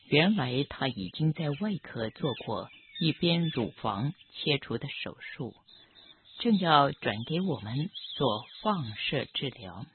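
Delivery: ordinary delivery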